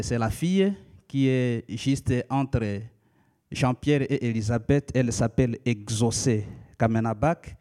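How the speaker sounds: ordinary talk, nothing marked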